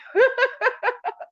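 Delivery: high, loud laughing burst; real laugh. Real, not acted. Host laughing at her phone mix-up, high laugh. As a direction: laugh out at the story.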